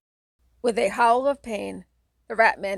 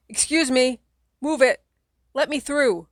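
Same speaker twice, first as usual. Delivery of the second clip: louder, higher shout over noise; 5 semitones higher, 5 dB louder than usual. Acted. You shouting through the crowd of teenagers, louder and higher. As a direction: shout to be heard over the band. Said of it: "you shout".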